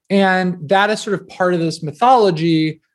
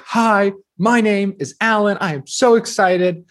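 higher, drawn-out over-eager self-introduction; espresso-fueled excitement; 5 semitones higher than usual. Retold or imitated. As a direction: imitate your overcaffeinated audition greeting. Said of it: "I had a bunch of espresso".

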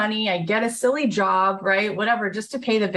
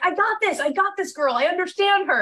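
high, eager reassurance; 10 semitones higher than usual. Retold or imitated. Telling the label she gets the artist, high and eager. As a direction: jump in, pitched way up, sure of yourself.

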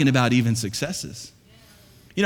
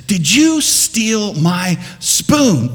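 slow, louder accusing question; blunt confrontation; 4 semitones higher, 6 dB louder, much slower than usual. Retold or imitated. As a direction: drag out the accusation. Wife confronts the pastor.

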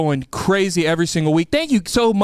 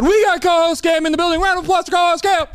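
hype emcee announcement; 9 semitones higher, 3 dB louder, faster than usual. Acted. Announcing co-host.

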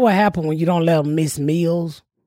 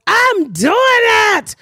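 put-on high-pitched, exasperated kid backtalk; sassy pop pitch; 14 semitones higher, 3 dB louder, slower than usual. Boy hollering back at his caregiver, high sassy pop. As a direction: pop the pitch up like a mouthy child. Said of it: "little pop pitch in his voice".

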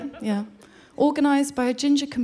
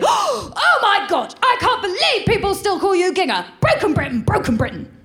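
high, gasping hammed-up horror; mock-outraged friend; 9 semitones higher, 4 dB louder than usual. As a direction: overplay the scandalised middle-class friend. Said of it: "And she'll say".

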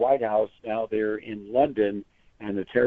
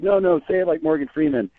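higher, mock-eager buddy goading; egging a friend on, pitched up; playful goading; 9 semitones higher than usual. Buddies urging a friend to do Freeman, playfully high. How buddies goad each other.

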